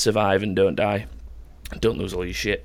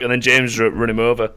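usual speaking voice

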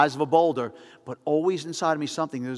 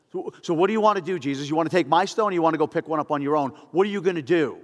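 louder, fast, goading questions; sneering crowd daring him to judge; taunting challenge; 3 semitones higher, 5 dB louder than usual. Acted. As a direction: push him into a corner with each question. Pharisees confronting Jesus with stones.

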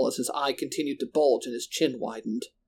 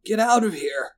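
high-pitched urgent shout; shouted order to flee; slurred shout; 7 semitones higher than usual. A narrator's reading. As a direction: cry out a warning, slightly slurred. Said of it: "I shouted, slurring some of my sounds".

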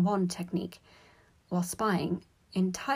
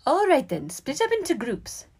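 high, raised teacher voice; high-pitched instructing professor; professor addressing class; 9 semitones higher, 6 dB louder than usual. A narrator's reading. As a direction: call the class to order. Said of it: "said Professor McGonagall".

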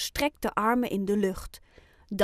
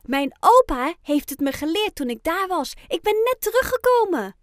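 put-on higher, louder young storyteller; lively Ruby voice; 7 semitones higher, 6 dB louder than usual. A narrator's reading. Ruby tells her friends about the dance, lively. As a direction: perk up, pitched higher and louder. Animated child character speaking in story.

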